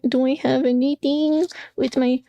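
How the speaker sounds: normal talking voice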